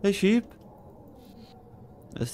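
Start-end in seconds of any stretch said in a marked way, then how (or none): 0.0-0.5 playful greeting. Pretend. Greets a toy sheep in game.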